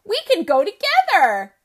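high, loud happy cry; delighted Rabbit. Acted. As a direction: Rabbit overjoyed to go with Goat. Rabbit delighted, claps paws.

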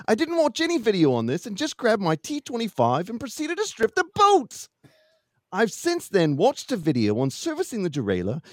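put-on swooping, improvised Minnesota accent; winged Minnesota accent. Finishing Brian's tale of stripped bolts, made-up Minnesota accent. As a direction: ham up a makeshift Minnesota accent. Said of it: "I'm gonna wing an, an accent".

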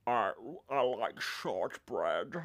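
very slow mock Nicolas Cage; a slow hammed-up Cage. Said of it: "impression of shortbread".

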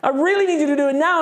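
high, urgent plea to God; acted-out desperate prayer; crying out. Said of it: "crying out to God".

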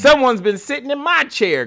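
high, loud mock growl; squeaky mama bear scolding. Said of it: "growled the Mama Bear".

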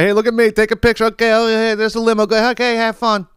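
put-on high, chirpy parent waving kids off; mock proud parent. Parent sending teens to prom limo, chirpy mock. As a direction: play the excited prom parent.

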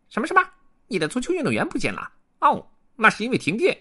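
squeaky, high beaver voice; lisping buck-toothed beaver. Acted. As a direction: chatter like a little beaver. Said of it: "第一只河狸说". (高音吱吱的小河狸)

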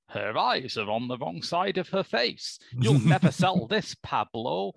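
languid pretentious art snob; snobby art critic. Retold or imitated. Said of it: "some snobby art critic looks down his pince nez".

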